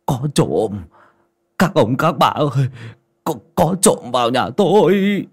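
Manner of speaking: put-on stammering old man appealing to neighbours; a touch of shakiness, calling out; trembling old man. A narrator's reading. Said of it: "Ông cụ chống gậy run run".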